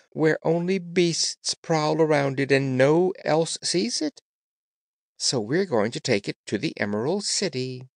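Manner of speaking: high, light, boastful cat; a vain little cat showing off; proud glass cat voice. Acted. Glass Cat speaking in story.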